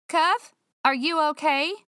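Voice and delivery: startled check-in; worried call. Acted. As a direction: call up in alarm. Calling after crash upstairs.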